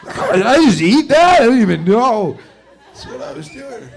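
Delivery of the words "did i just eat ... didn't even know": mock disbelief. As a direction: act baffled at your own snacking. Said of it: "you go".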